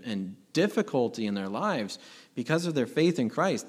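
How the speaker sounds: ordinary talk, nothing marked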